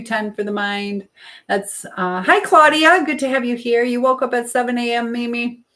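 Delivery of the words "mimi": ordinary delivery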